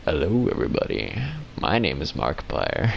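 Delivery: very low, soft silky smooth voice; deep velvety YouTuber intro; silky smooth impression of the YouTuber's deep voice; Markiplier impression. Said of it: "He got that silky smooth voice".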